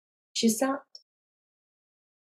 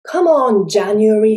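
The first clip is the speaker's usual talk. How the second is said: louder, coaxing appeal; urging; 9 dB louder than usual. Acted. Old woman urges January to relent, coaxing. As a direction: nudge a stubborn brother along.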